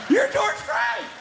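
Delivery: fanboy losing it; high stretched-out starstruck yell; starstruck shout. Retold or imitated. Starstruck fan blurts at star.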